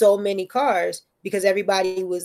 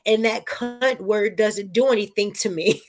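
slow and firm; slower than usual. Retold or imitated. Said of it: "I was like".

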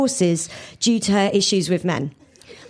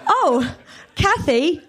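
higher, slow playful pun; quip; 9 semitones higher, slower than usual. A narrator's reading. [name] quips to Kathy about her name, playful and high. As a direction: tease the name with a grin. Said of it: "I quipped".

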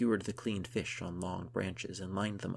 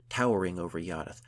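usual speaking voice

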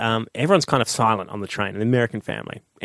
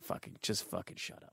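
very soft, irritated mutter; muttered annoyance; 5 semitones lower, 20 dB quieter than usual. Retold or imitated. Passenger fed up with noisy tourists, muttering under his breath. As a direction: grumble it under your breath.

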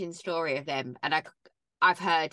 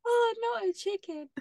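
crying with laughter; 12 semitones higher, 5 dB louder than usual. Real, not acted. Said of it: "laughing fit".

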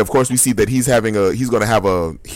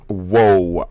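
hiccup gasp; 3 semitones lower than usual. Real, not acted. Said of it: "That was horrible".

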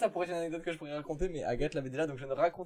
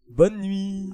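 theatrical goodnight; 5 semitones higher, 9 dB louder than usual. Real, not acted. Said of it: "Oh, l'élocution".

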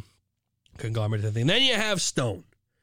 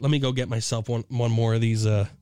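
usual speaking voice

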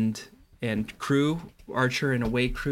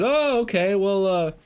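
high-pitched, over-casual invitation; hammed-up innocent friendliness, pitched up; feigned nonchalance; 6 semitones higher than usual. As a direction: stall them with chummy small talk. Greylick stalling with fake friendliness.

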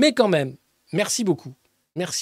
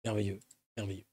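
much lower, much softer savouring murmur; low purr; 11 semitones lower, 13 dB quieter, faster than usual. Pretend. A villain savouring the scene, low soft murmur. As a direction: relish it like a villain.

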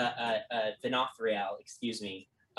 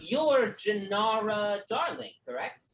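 a higher, slower polite query; lightly voiced politician; 9 semitones higher, 3 dB louder, slower than usual. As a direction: lean in and confirm her name. Lance addresses player character.

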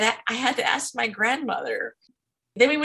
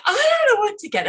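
much higher, fast, loud panic; frantic panicked squeak; 12 semitones higher, 5 dB louder, much faster than usual. Retold or imitated.

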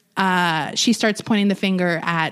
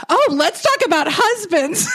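eager jab; 7 semitones higher, 3 dB louder than usual. Retold or imitated. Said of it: "Kim is immediately like".

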